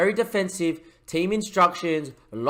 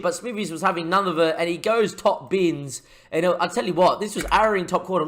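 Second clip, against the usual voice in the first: lively match commentary, building on the chances; play-by-play commentary; 4 semitones higher, 4 dB louder than usual. Acted. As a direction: call the game like a TV commentator. Live play-by-play of his match.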